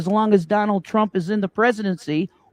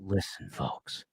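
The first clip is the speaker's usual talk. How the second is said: low, much softer, grave whisper of folks; serious Alex Jones; 9 semitones lower, 17 dB quieter, slower than usual. Retold or imitated. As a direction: lean in like a dead-serious radio host. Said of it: "Alex Jones voice is when he gets real serious".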